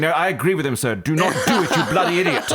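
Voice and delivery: hammed-up English devil voice, laughing; posh English devil. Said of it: "an English accent".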